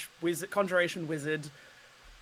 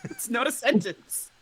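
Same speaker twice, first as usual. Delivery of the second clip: amused laughter; 3 dB louder, slower than usual. Real, not acted. Laughing about untranslatable Australian idiom.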